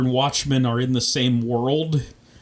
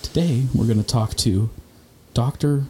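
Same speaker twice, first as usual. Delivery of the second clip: AM radio voice. Pretend. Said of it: "I wanna do an A M radio voice podcast".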